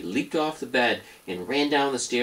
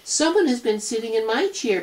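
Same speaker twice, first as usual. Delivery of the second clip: much higher small bear voice; high little cub voice; tiny Baby Bear voice; 5 dB louder than usual. Acted. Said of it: "in his tiny little voice".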